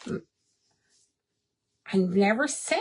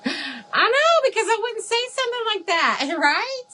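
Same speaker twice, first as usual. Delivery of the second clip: high, fast, laughing protest; giggly high protest; laughing embarrassment; 12 semitones higher, 4 dB louder than usual. Real, not acted. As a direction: laugh it off, flustered. Laughing, mock-offended at comment.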